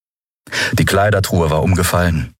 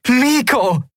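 high, joyful call of a name; jubilant. A narrator's reading. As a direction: cry out in delight, pitched way up. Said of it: "jubelte Endriel in den Aufzeichner". (hoch und voller Freude gejubelt)

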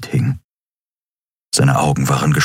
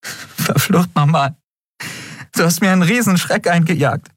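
laughing and crying; 12 semitones higher than usual. A narrator's reading. Endriel scolds Miko while laughing and crying. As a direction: scold him through laughter and tears. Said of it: "Sie lachte und weinte gleichzeitig".

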